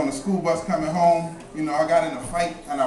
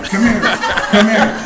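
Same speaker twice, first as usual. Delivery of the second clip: slow, loud monster call with arms out; 6 semitones higher, 11 dB louder, much slower than usual. Retold or imitated. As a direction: lurch toward the kids, groaning the words. Scaring kids in costume.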